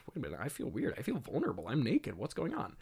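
hushed, swooping mock confusion; quiet, woozy imitation of Adam's confusion; dawning bewilderment. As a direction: softly act out growing disorientation. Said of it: "starts to feel the effects".

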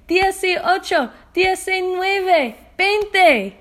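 loud energetic counting in Spanish. Shown as a demonstration. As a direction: shout the numbers with gusto. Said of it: "Let's count up in Spanish".

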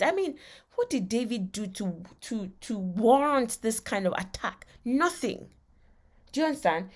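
usual speaking voice

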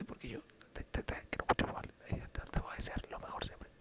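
mouthing words with no voice, whispered; voiceless whisper. Retold or imitated. Father speaking into a broken mic, barely-there whisper. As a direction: whisper it like a dead microphone. Dad's microphone cut out mid-speech.

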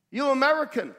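mock kid calling across a yard; schoolkids' question. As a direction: call out a curious question as a kid would. Retold schoolkids questioning the newcomers.

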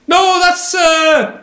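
high, strained bad Irish impression; mock Irish accent. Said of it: "I'm gonna fuck up his accent, but he was Irish".

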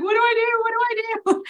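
high, frantic what-do-I-do; panicked. Retold or imitated. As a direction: retell the panic, voice shooting up. Panicking over missed award calls.

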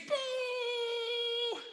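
playful boo. Pretend. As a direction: hold a high boo at the villain. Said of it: "let's make the loudest boo".

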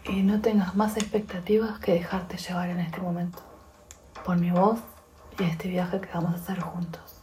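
soft, unhurried meditation voice with long pauses; guided meditation. Acted.

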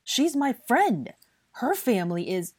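high, loud, slow protest; drawn-out, indignant appeal; impassioned outburst. A narrator's reading. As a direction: protest with feeling. Blaise pleads angrily.

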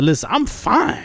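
lightly acted drunk insisting on sobriety; mock tipsy reassurance; insistent drunk. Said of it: "drunk people always repeat themselves".

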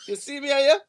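put-on Caribbean accent. Repeating the Caribbean bit.

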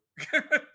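high loud laugh; burst of laughter. Real, not acted. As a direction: cracks up at own joke. Laughs at own retort.

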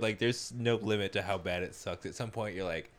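ordinary talk, nothing marked

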